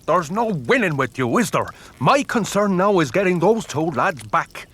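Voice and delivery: losing patience with the maid's tales; exasperated. Acted. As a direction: throw up your hands and raise your voice. Exasperated argument with superstitious maid.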